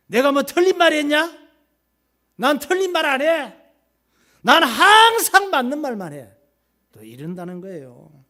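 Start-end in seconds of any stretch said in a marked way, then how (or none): 0.1-6.3 높고 큰 소리로 쏘아붙이는 아내; screaming wife. Acted. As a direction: shriek it at him, outraged. Wife insisting she's right. (남편에게 소리 지르는 아내, 높고 크게)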